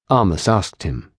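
plain reading voice

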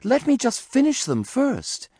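very high, anxious bunny voice; pleading. A narrator's reading. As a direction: beg for your books in a little high voice. Bunny son begs for his books.

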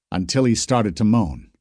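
ordinary delivery, nothing marked

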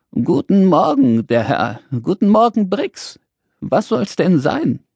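high, cheery sing-out greeting; friendly old shopkeeper. Acted. Old shopkeeper greets Dad and Brix, very friendly. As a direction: greet customers with extra friendliness. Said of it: "So freundlich war sie sonst nämlich nie".

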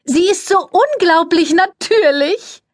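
very high, sugary society voice; gushing press lady. A narrator's reading. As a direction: coo it, all air kisses and fuss. Cordelia voiced in story.

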